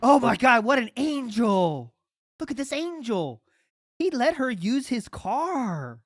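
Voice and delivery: sarcastic gushing. Acted. Host mocking that he let her use his car, sarcastic gushing. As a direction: gush in fake awe, stretching the words.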